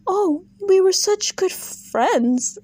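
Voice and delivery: high, slow fake-sweet mimicry; mocking Meredith. Mocking Meredith's fake friendship claim, high and slow. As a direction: simper it back in a falsetto sneer. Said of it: "with the whole".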